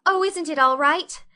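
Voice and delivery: high, loud anxious question; worried girl fearing the worst; dismayed girl. Acted. Anne asking Marilla about the cake, high and anxious.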